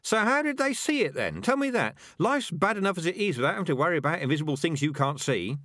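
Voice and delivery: very high, frantic sarcastic rat; nervous, mad sarcasm. A narrator's reading. Rat demanding proof of invisible things, nervously sarcastic. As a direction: challenge them sarcastically, pitch shooting up. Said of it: "nervous to the point of mad sarcasm".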